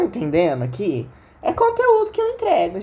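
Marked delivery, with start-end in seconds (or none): 1.4-2.8 high proud boast. Acted. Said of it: "gente, é conteúdo que eu entrego".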